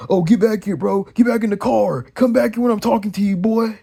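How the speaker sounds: put-on bossy yell; mock commanding relative. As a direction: call someone back like an irate parent, mockingly. Mimics relative ordering him back.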